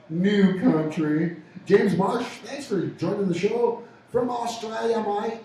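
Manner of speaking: mock Aussie radio shout-out; Australian accent. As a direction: lay on a hammy Australian greeting. Greets Australian listener in put-on accent.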